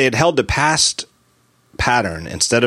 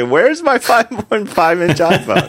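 outraged customer; 11 semitones higher, 4 dB louder, slower than usual. Retold or imitated. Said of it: "How many people are gonna say".

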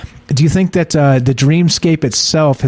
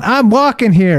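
mock New York "I'm walking here"; 7 semitones higher than usual. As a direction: bark it like a cabbie stepping into traffic. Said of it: "no, it's not".

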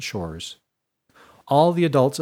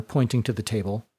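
usual speaking voice

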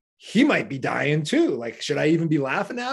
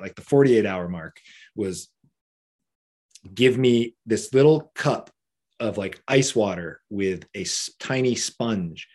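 ordinary talk

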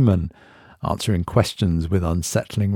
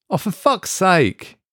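higher, slower exasperated groan of words; fed up to the back teeth; 11 semitones higher, slower than usual. A narrator's reading. Mark exasperated at device.

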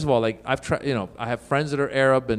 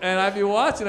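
ordinary delivery

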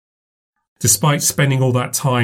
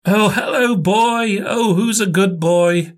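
high, cooing puppy talk; talking to a dog; 10 semitones higher, slower than usual. Acted. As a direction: coo over the puppy, slowly. Greeting excited puppy.

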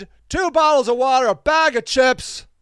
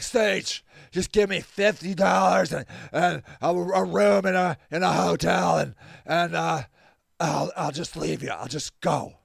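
halting, softly mumbled drunk impression; drunk caller; 4 semitones lower, 6 dB quieter than usual. Drunk man phoning his helper for money, drunk imitation. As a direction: imitate a drunk pleading, low and soft. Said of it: "drunker than ever. He's like".

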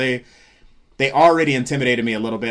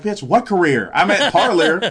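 higher-pitched comic disbelief, breaking into laughter; retold incredulity; 7 semitones higher, 5 dB louder than usual. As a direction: throw back an incredulous, laughing comeback. Retold comeback to career threat.

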